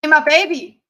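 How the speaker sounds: ordinary talk, nothing marked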